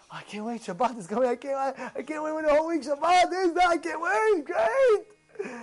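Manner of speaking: hammed-up man bursting to begin; crazed eagerness. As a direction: start hushed, then climb into giddy anticipation. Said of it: "People think that he's nuts".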